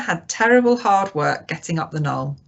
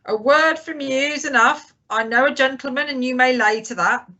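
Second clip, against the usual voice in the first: high-pitched cheerful call; hearty call, well up in pitch; cheery shout; 6 semitones higher than usual. A narrator's reading. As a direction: keep it cheerily raised to carry. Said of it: "shouted Long John cheerily".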